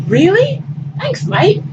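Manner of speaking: light delighted thanks; chuffed Bering. Acted. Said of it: "try and fail to imitate".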